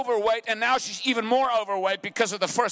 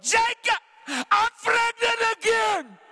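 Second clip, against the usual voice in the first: Leah's crying voice; 11 semitones higher, slower than usual. Retold or imitated. Leah telling Jacob she is pregnant, high crying wail. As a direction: wail the news up high and drawn out. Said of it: "That's the way she talked".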